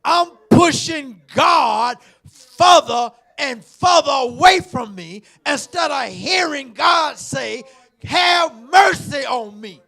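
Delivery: loud, high, slow preaching shout; preacher shouting to the church; full-force preaching. Acted. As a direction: drive it home at the top of the voice.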